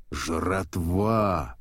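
mildly hammed-up hungry shark howl; ravenous howl. A narrator's reading. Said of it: "вопил он".

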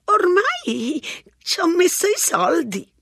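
high, slow cracked crone voice; a rambling old lady, unbothered; old woman voice. A narrator's reading. Old woman at the payphone chatting, high and unhurried. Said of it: "la vecchia guardò gli spiccioli".

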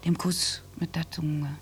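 slow, voiceless whisper. Acted. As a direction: let the words fall into a hush. Erinnert sich an den Kuss. (langsam geflüstert)

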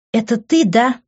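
high-pitched outraged accusation; accusing cry. A narrator's reading. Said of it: "закричала она". (обвиняющий выкрик)

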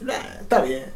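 softly mocking condescending shrug; lightly mimicked condescension; condescending imitation. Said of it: "tú eres muy condescendiente".

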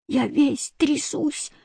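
high, soft, slow shivering complaint; a little boy shivering and complaining, high and slow. A narrator's reading. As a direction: whimper it, teeth chattering with cold. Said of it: "жаловался негритёнок".

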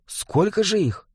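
nervous question under the breath; anxious whisper. Acted. Испуганный Жига. (испуганно, вполголоса)

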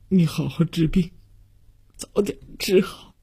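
sobbing farewell, high and broken; words broken up by heavy sobs, long gaps; 强烈抽泣. A narrator's reading. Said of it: "也被强烈的抽泣打乱，". (抽泣得断断续续，词语不清)

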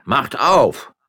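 high, loud command; demanding. Acted. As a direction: bang out the order. Judge demands door opened. (Stadtrichter fordert die Amme laut auf, zu öffnen)

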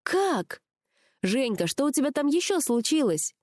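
high, eager questioning; pry for news, pitched high; burning curiosity. A narrator's reading. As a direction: friend bursting to know. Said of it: "сгорала от любопытства Аня".

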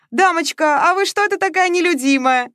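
very high, loud teasing; mocking guest. A narrator's reading. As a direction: needle the unsociable lady. Guests needle Masha. (гости поддевают Машу, очень высоко и громко)

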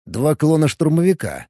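plain reading voice

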